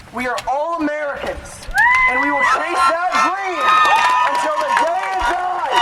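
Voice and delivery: loud, high rallying cry; impassioned shout. Real, not acted. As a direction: shout it out to the whole crowd. Climax of rally speech.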